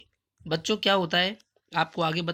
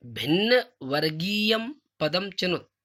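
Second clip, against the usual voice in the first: slow recitation; 3 semitones higher, 4 dB louder, slower than usual. Shown as a demonstration. Teacher reads instruction.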